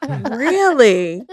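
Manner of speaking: loud mock-amazed question. Stranger hearing the hair routine, imitated as amazed. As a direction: imitate her wide-eyed amazement. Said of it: "And she is fucking amazed".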